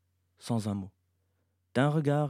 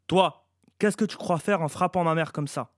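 raised, louder confrontation; angry challenge; 5 semitones higher, 5 dB louder than usual. A narrator's reading. Boy confronts the one who hit his mother, angry. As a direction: challenge the attacker indignantly.

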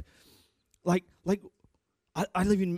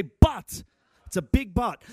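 loud, high punch on "But"; shouted but; 4 semitones higher, 5 dB louder, slower than usual. Real, not acted. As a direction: make them say "But" with you.

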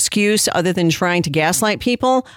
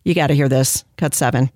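ordinary talk

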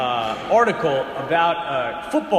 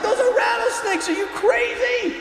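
panicked scream. Acted. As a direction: scream in terror at the snakes. Kids screaming at rattlesnakes.

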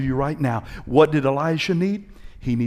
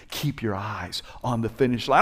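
normal talking voice

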